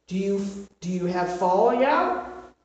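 ordinary talk

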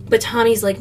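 ordinary delivery

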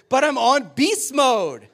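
slow, loud, high growl like a little beast; growly beast voice. Retold or imitated. Six-year-old daughter explaining to dad on the field, slow loud growl. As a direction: growl it out like a kid playing monster. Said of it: "Stop growling at people".

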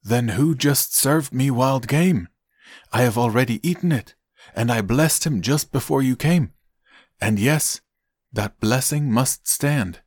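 a touch of shock, stressing each phrase; shaken. A narrator's reading. Isaac learns he blessed the wrong son, mildly shaken. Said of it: "began to tremble uncontrollably and said".

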